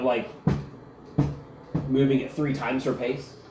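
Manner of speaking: normal talking voice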